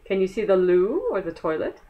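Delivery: plain reading voice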